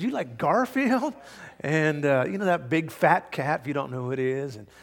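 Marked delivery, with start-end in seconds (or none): 0.0-1.1 surprised question. Retold or imitated. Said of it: "And I said".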